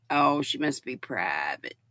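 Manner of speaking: normal talking voice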